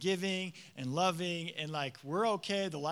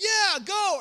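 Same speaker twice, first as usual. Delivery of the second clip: much higher and louder, eager yes; cheering on; 12 semitones higher, 11 dB louder than usual. Acted. Church folks cheering a believer on fire, excited. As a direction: shout them on their way. People who should cheer someone on.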